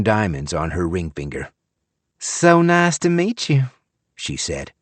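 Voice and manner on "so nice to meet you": put-on syrupy Southern accent. A narrator's reading. Young wife greeting Frank in syrupy Southern accent. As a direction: gush in a Gone with the Wind Southern accent. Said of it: "with a syrupy accent".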